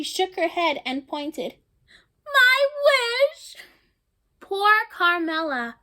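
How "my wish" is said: put-on high, loud, upset little girl's cry; little girl's wail. Carmela pointing at her crushed wish, in a high, loud, upset child's cry. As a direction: wail it like a heartbroken small child.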